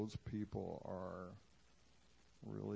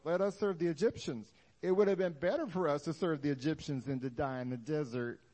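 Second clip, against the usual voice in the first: higher, louder whining complaint; whiny complaining Israelites; 4 semitones higher, 8 dB louder than usual. Acted. Israelites complaining to Moses, loud whiny voice. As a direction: whine like a born martyr. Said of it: "Don't you just hate the sound of my voice?".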